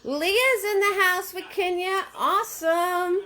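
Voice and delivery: high, sustained, drawn-out cheer; excited calling out. Real, not acted. Host welcoming Leah and Kenya to the party, excited. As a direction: call it out high, stretching the words.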